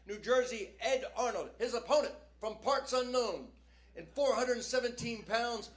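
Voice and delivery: rising, booming wrestling-announcer build; milk each intro like a ring announcer. Retold or imitated. Comic telling a joke in a wrestling announcer's voice.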